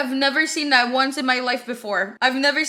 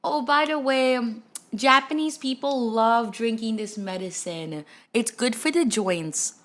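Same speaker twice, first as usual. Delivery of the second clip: softer, slower lightly voiced woman's advice; a hint of a gentle stranger recommending medicine; light imitation of a soft-spoken woman; imitating the woman; 6 dB quieter than usual. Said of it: "A Japanese woman was like".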